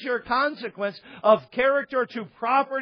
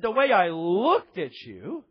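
slow, stretched-out mock disbelief, rising at the end; retold incredulous question. Pastor questioning the couple's accusation, mock incredulity. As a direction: repeat the charge slowly as if baffled.

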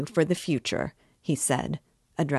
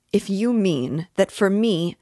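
stammering; 5 semitones higher, 6 dB louder than usual. Acted. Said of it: "he began, stammering".

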